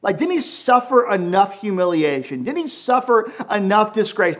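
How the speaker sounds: distraught woman crying out to God; Mary's lament. Retold or imitated. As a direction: voice Mary's anguished plea to God. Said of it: "She's going like".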